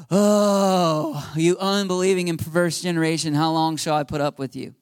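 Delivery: imitating a heavy, fed-up sigh; deep exasperated sigh. Jesus to the crowd about their unbelief, exasperated sigh. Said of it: "He sighs deeply. He's like".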